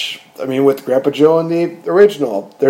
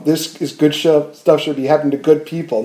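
ordinary delivery

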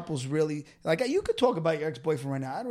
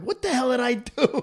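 mock bafflement; 7 semitones higher, 4 dB louder than usual. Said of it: "I'm like".

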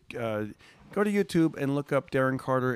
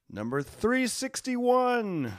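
proud; 11 semitones higher, much slower than usual. Real, not acted. Proud chart ranking.

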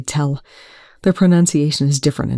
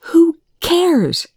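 sharp high outburst; shouting; 14 semitones higher, 3 dB louder than usual. A narrator's reading. Eli shouting at Young to back off. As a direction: snap back, half out of the chair. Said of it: "Eli was shouting at him".

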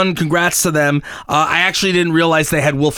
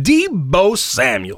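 Deebo Samuel drawn out slow, each syllable weighty; with attitude and conviction; much slower than usual. Shown as a demonstration. Showing cohosts how the name must be said, slow with conviction. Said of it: "say it with attitude and, and conviction".

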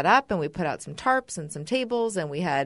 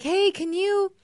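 chirpy volunteer ask; 12 semitones higher, slower than usual. Retold or imitated. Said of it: "somebody's like".

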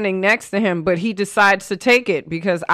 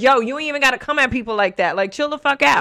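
higher-pitched, irritated retort; retold annoyance; 6 semitones higher, faster than usual. Telling off the woman at the gas station, retold irritation. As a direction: relive telling someone off, quick at first. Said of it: "Then that actually did piss me off".

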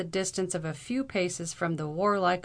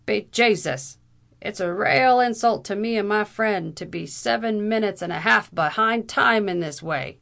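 loud, outraged Irish bluster; awful indignation; 3 semitones higher, 7 dB louder than usual. A narrator's reading. Captain berating latecomers for the insult, loudly indignant. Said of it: "in a tone of awful indignation".

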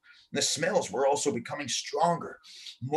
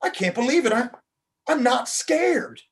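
amazed; 9 semitones higher, 4 dB louder than usual. A narrator's reading. Boy amazed while floating.

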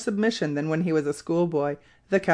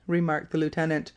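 plain reading voice

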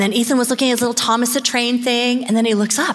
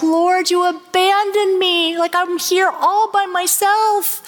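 whiny complaint; 9 semitones higher, slower than usual. Retold or imitated. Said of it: "sometimes we're like".

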